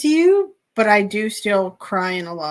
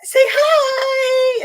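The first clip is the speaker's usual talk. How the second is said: high, loud hello to camera; cheerful shout; 5 dB louder than usual. Retold or imitated. Vlogger friend greeting the camera, loud and bright. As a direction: beam it out for the vlog. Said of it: "she was like".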